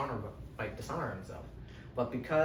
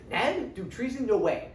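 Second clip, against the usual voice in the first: incredulous; 7 semitones higher, 3 dB louder than usual. Acted. Northerners disbelieve Ned's treason.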